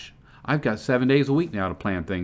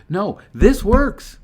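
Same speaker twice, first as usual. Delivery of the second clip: "No, this works" slow and raised, leaning hard on "this"; emphatic insistence; 9 semitones higher, 6 dB louder, much slower than usual. Acted. To someone pitching a business, a slow emphatic correction. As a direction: stretch out "this" and land "works" firmly.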